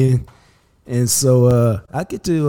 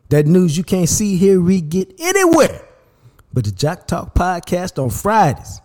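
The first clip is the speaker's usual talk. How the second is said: promo slogan; 4 semitones higher than usual. Acted. Podcast slogan for Friday segment.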